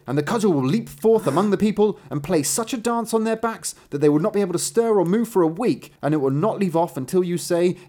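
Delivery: high, loud instruction with storybook relish; emphatic master. A narrator's reading. Master explaining the sack to his apprentice, emphatic.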